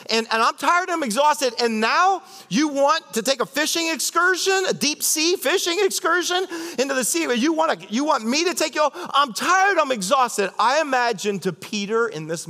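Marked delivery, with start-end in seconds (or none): 0.1-8.7 higher, whiny exasperated Peter; a worn-out fisherman protesting; mock tired whine; exasperated complaining. 8.9-10.5 higher, whiny exasperated Peter; a worn-out fisherman protesting; mock tired whine; exasperated complaining. Voicing tired Peter again.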